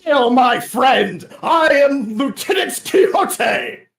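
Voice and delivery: loud, slow, high heroic hail; booming knight greeting. Acted. Lieutenant Quixote hailing Nextra, loud slow heroic proclamation.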